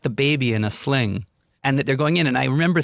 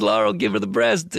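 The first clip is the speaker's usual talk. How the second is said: imitating Woody. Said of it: "he goes".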